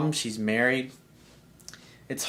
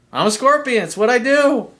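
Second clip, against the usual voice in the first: high, louder matter-of-fact scorpion voice; scorpion's reply; 8 semitones higher, 5 dB louder than usual. Retold or imitated. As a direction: shrug it off, it's just nature. Scorpion answers in retold fable.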